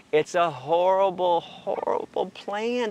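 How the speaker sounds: denouncing welfare reform, slow and emphatic. Real, not acted. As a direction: lean hard on each word. Emphatic condemnation.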